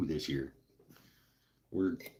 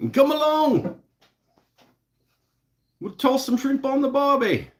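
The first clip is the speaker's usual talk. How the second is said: hammed-up Australian accent, much higher and louder; 8 dB louder than usual. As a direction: play a cheesy Australian TV host. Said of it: "It's a terrible accent".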